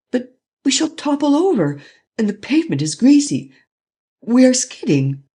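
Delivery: terrified passenger shrieking warnings; high alarmed voice rising in panic; frightened cry. A narrator's reading. Scared passenger in speeding car.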